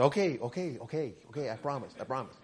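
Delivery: very soft and low, under the breath; imitated hushed, submissive agreement; meek retold promise. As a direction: drop right down to a sheepish murmur.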